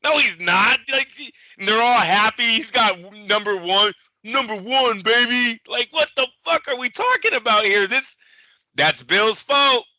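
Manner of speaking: high, loud incredulous rant; exasperated rant. Real, not acted. Host ranting to co-host about the undeserved title, high and loud. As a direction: let your disbelief spill over.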